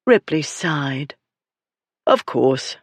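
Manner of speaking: ordinary delivery, nothing marked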